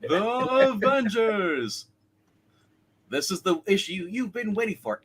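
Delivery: slow, drawn-out announcer voice; Ted Knight announcer. Acted. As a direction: stretch it like an old TV announcer. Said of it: "the Ted Knight kind of thing".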